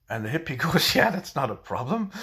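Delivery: laughing through the words; can't keep a straight face. Real, not acted. Teller laughs at own joke.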